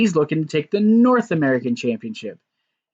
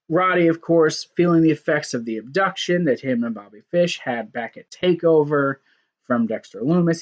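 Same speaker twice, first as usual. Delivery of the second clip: ordinary talk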